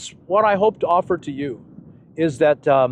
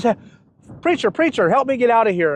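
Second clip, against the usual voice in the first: calling out; 7 semitones higher than usual. Retold or imitated. Man in hole calls preacher.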